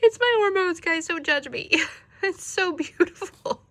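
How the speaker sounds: high, loud, laughing through tears; overwhelmed high-pitched gush; happy-tearful. Real, not acted. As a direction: wail happily, half laughing at yourself. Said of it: "I'm gonna cry".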